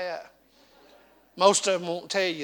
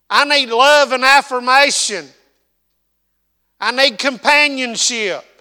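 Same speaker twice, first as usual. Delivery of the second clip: preaching at full force; 8 semitones higher, 10 dB louder than usual. Real, not acted. Preacher listing needs to his congregation, full force. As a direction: pound each need home like a revival preacher.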